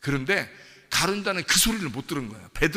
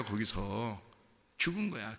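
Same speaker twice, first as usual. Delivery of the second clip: ordinary talk, nothing marked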